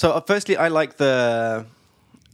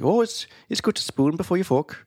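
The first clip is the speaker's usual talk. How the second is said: higher-pitched, amateur Irish lilt; attempted Irish accent; 7 semitones higher than usual. Shown as a demonstration. Showing co-host the spoon pun, attempted Irish accent. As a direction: have a rough go at an Irish accent. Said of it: "like, in an Irish accent, which I can't do".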